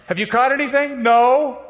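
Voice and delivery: high, loud call and answer; Jesus and disciples calling across the lake, loud. Retold or imitated. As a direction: shout it across the water. Retelling shore exchange.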